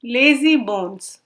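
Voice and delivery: ordinary delivery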